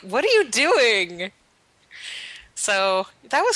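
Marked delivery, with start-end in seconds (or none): 0.0-1.3 higher, drawn-out mock disbelief; imitated bewilderment. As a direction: act out a baffled friend, stretching the words. Retells friend's reaction to her screaming.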